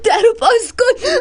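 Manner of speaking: high sobbing cry to open up; crying plea. Acted. Locked-in girl cries to be let out. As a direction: beg through tears.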